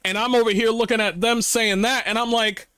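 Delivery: ordinary talk, nothing marked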